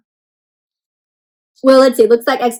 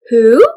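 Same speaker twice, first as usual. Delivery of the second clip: WHO loud, voice going up at the end; drawn-out "Who?" swooping upward; questioning rise; 2 semitones lower, 8 dB louder than usual. Shown as a demonstration. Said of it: "When your voice goes up at the end".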